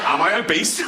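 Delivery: asking through hammed-up laughter; jolly fat patient. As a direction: play a patient chuckling merrily at the doctor. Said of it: "They're jolly".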